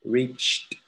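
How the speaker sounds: REACHED as one syllable, CH then a released T; ch-T ending. Shown as a demonstration. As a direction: model Reached with a crisp ch plus T at the end. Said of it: "aquí termina con ch".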